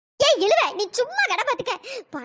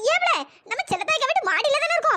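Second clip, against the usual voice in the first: ordinary delivery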